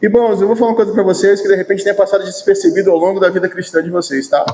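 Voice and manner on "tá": ordinary delivery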